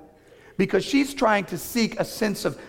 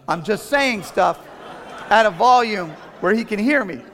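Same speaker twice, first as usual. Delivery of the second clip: mock denial; 5 semitones higher, 5 dB louder than usual. Real, not acted. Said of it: "I'm not yelling".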